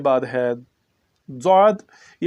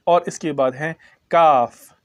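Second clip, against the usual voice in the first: ordinary talk, nothing marked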